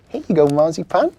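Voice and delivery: put-on high, loud young boy; bright little boy offering gifts; child voice.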